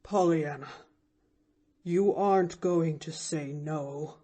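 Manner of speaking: deep with emotion. A narrator's reading. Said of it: "a voice deep with emotion".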